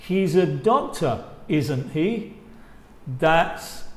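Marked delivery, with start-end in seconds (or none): 0.1-2.3 "isn't he?" lifting at the end; rising tag. Shown as a demonstration. Teacher repeating the real question, rising tune. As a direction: ask it like you don't know. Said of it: "El primero es con la entonación que sube".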